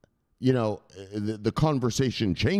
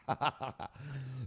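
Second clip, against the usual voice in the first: breathy laugh; 4 semitones higher, 11 dB quieter than usual. Real, not acted. Laughs before mocking line.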